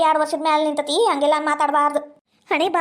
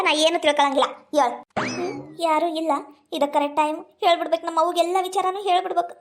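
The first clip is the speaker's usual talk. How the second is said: usual speaking voice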